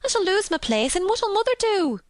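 high, fretful wailing; tearful panic, pitched high throughout; half crying. A narrator's reading. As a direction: lament it in a high Yorkshire maid voice. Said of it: "half crying".

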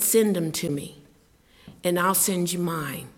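ordinary delivery

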